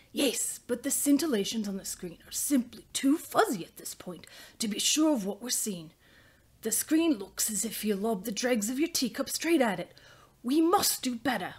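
put-on loud, forceful New Zealand accent for a father. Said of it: "I'll give it a shot for Ernest".

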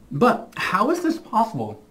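talking with a retainer in; a little lisp from retainers; slight lisp. Real, not acted. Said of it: "have a little lisp".

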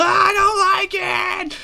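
high, loud comic panicked scream; screaming bloody murder. Acted. Treant as a squirrel scrambles up, panicked scream. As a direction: scream it high in mock terror. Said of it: "screaming bloody murder".

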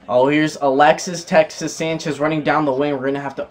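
ordinary delivery

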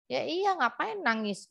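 lightly acted, exasperated retort; exasperated husband. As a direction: imitate a husband dismissing the tears. Husband chiding wife again.

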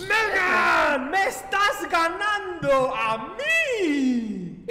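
mock outrage. Teacher to a student beating her at the game, mock outrage. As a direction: draw it out as a stunned protest.

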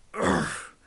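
low grunt of frustration; disgusted groan. Real, not acted. Fed up with the game.

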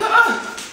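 high, sharp gasp of excitement; excited gasp. Real, not acted. Seeing the new guitar, excited gasp.

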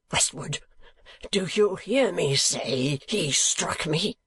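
high, strained, half-whispered fury; a drunk man hissing his outrage, then crying out; outraged demand. A narrator's reading. Sir Mulberry to the crowd after the blow, strained fury.

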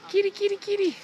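very high, soft sing-song cat call; high falsetto kitty call. Real, not acted. A walker calls the stray cats in a very high soft voice.

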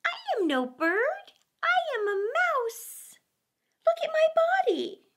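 high, squeaky pleading bat. A narrator's reading. Bat arguing with the weasel, high and squeaky. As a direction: talk fast to save your life.

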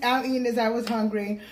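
ordinary talk

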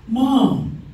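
high, loud call of Mom. Retold or imitated. Daughter calling her mom, high and loud. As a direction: yell it across the house.

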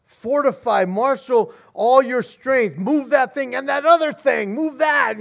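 officer rallying troops, shouting orders; barked commands. Acted. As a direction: command the soldiers to man the fort. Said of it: "officers beginning to give orders".

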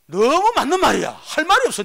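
loud, high exclaim of defeat; preaching shout. Real, not acted. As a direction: cry out, conceding fully. (회중에게 크게 외치는 인정)

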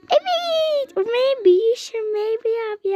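squealing fan reply; gushing fan. Acted. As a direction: gush over your idol. Starstruck fan answers.

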